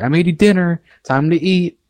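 imitating mom serving dinner. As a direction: announce dinner like his mom. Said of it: "She's like".

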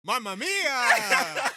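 high, drawn-out, loud Italian Mamma mia; hammed-up Italian cry; mock Italian.

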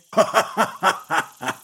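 big mocking hoot of laughter. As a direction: throw your head back and hoot. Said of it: "hooted with laughter".

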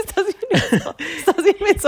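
high, laughing teasing; laughing tease. Real, not acted. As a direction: tease him while laughing.

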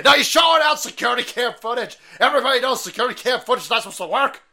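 high, loud and fast; mocking shout. Ranting host mocks critics, high and loud. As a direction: bellow it out in mock outrage.

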